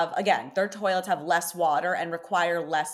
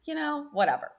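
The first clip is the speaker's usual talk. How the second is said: shrugging indifference; 8 semitones higher than usual. Retold or imitated. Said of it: "I was just like".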